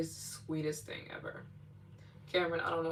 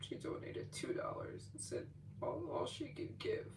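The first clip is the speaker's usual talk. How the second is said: hushed whisper, softer; 10 semitones higher, 9 dB quieter than usual. Real, not acted. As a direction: whisper it like a tender secret. Retelling the small donation.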